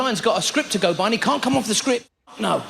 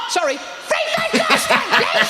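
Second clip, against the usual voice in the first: repeated shout; 11 semitones higher, slower than usual. Retold or imitated. Customer shouting the order again to the clerk.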